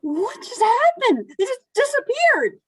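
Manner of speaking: stunned disbelief. Acted. Susan reacting to aliens vanishing, rising squeak. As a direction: voice climbing high and fast in shock.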